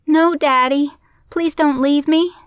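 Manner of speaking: put-on high, loud child's plea; shouting little boy. A narrator's reading. Little boy begging his father to stay, shouting. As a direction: cry out like a desperate small child. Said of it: "the boy shouted".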